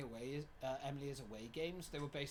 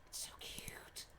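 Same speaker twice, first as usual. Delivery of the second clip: softer unvoiced whisper of "It's so cute"; 8 dB quieter, slower than usual. Real, not acted. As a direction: whisper it again, lower and hushed. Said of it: "look at the heart".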